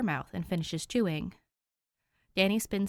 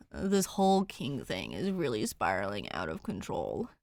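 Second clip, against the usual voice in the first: weary; 8 semitones lower than usual. Acted. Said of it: "exhales a heavy sigh".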